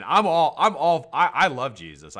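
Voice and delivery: normal talking voice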